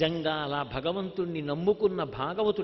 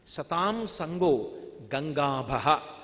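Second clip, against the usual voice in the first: drawn-out intoning of సతాం సంగో, each syllable held; slow recitation; 4 semitones lower, much slower than usual. Acted. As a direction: intone the maxim slowly, like a quoted scripture. Repeated Sanskrit maxim, slowly intoned. (సతాం సంగో నెమ్మదిగా సాగదీసి పఠించడం)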